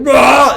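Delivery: loud, high mock battle roar; mock rage roar. Coach showing a scared guy how to get mad, loud mock roar. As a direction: let out a hammed-up roar of rage. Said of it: "I'm like".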